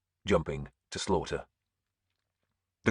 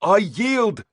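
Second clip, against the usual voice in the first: loud, much higher cry of surrender; 7 dB louder than usual. A narrator's reading. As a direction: shout the surrender desperately. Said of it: "he cried".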